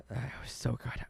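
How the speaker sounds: holding back tears; moved to tears, softly; tearing up. Real, not acted. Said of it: "Tearing up".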